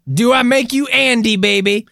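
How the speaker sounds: high, playful quote; hammy catchphrase. Acted. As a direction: ham up the famous line. Austin Powers quote.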